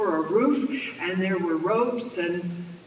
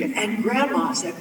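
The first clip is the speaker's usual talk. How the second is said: usual speaking voice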